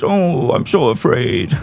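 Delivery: slow, sarcastic mock fright; mock fear. Mocking the rival's posted fear, slow and sarcastic. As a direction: drawl it out sarcastically. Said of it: "post your Instagram stories".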